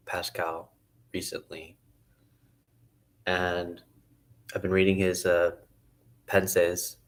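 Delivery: ordinary delivery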